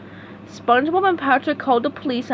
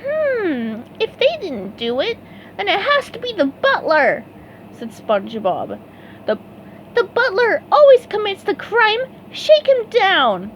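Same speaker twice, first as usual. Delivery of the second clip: very high, eager cartoon sponge; bright squeaky helper ready to go; eager SpongeBob voice; 10 semitones higher than usual. A narrator's reading. SpongeBob volunteering to his boss, high eager voice.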